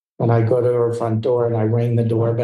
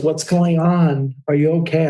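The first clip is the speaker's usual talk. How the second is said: gently alarmed, checking on him; concerned star; 6 semitones higher than usual. Retold or imitated. Star noticing the makeup artist's tears, worried.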